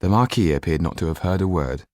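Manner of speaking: ordinary delivery, nothing marked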